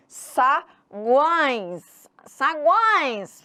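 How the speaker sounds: SAGUÕES drawn out with a strong nasal ÕE; nasal sound. Shown as a demonstration. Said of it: "O tio também é nasal". ("Saguões" bem anasalado e alongado)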